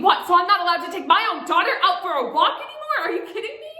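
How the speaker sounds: loud, fast, high-pitched tirade; acted furious outburst; angry yelling. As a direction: yell it in indignant disbelief, pitch climbing to the end. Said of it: "he started yelling at me".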